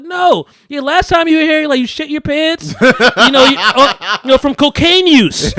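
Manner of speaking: high, loud, through laughter; laughing tease. Retold or imitated. Friend mocks Ezra's accident, high and laughing. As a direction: giggle out the embarrassing story.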